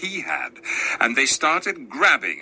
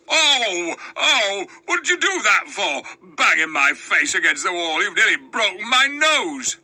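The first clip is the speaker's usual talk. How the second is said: howling dwarf; 7 semitones higher than usual. A narrator's reading. Diggle howls at Tirian, rubbing his sore nose, high wailing. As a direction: howl it like a hurt bully. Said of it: "rubbing his nose and howling".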